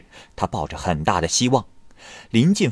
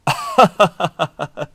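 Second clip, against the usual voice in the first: high, rueful falling chuckle; sheepish laugh at being fooled; self-mocking laugh; 10 semitones higher than usual. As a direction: laugh at your own folly. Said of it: "自我嘲解地笑着说".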